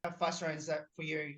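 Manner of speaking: ordinary talk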